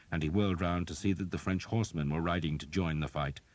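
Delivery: ordinary delivery, nothing marked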